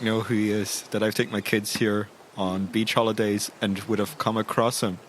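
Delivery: ordinary delivery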